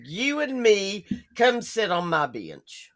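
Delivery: ordinary delivery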